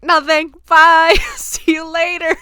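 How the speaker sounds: high, loud, hasty brush-off; breezy evasion. Retold or imitated. Daughter dodging mom's question, loud and breezy. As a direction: chirp a goodbye to dodge the question.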